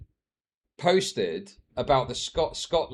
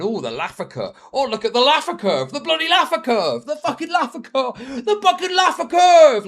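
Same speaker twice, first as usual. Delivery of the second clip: starts mild, climbs to a high loud frenzy; building mock nerd excitement; 12 semitones higher, 10 dB louder than usual. Streamer mocking economists obsessed with Laffer curve, building to a high yell. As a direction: ramp up into a shrieking fanboy.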